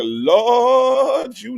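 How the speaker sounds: high drawn-out Lord. Shown as a demonstration. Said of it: "gotta put a little shake in your voice".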